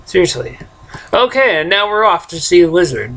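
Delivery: ordinary talk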